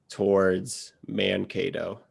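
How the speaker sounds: usual speaking voice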